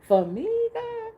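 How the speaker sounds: high, teary, overwhelmed squeak; almost crying with joy; tearful amazement. Retold or imitated. Receiving God's gift, teary high amazement.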